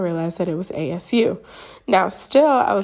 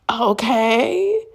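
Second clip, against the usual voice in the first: higher, stretched, rising okay; acted-out sceptical okay; doubtful; 4 semitones higher than usual. Reacting to her silence.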